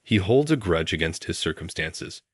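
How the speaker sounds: usual speaking voice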